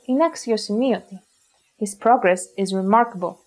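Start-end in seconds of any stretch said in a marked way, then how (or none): none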